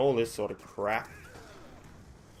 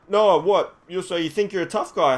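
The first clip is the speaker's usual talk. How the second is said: loud, higher-pitched challenge; confrontational officer; 7 semitones higher, 6 dB louder than usual. Retold or imitated. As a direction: imitate a cop squaring up to a suspect. Acts policeman sneering at him.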